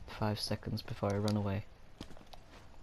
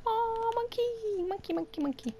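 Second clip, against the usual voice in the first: wailing grief. Real, not acted. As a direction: wail over the lost pet. Crying out over dead monkey.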